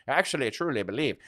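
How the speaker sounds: quick, hammed-up Southern American accent; a bad Southern accent, tossed off fast like an old football coach; Butch Davis Southern accent. Streamer mimicking the old Miami Hurricanes coach, bad Southern accent. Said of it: "that's a bad Southern accent".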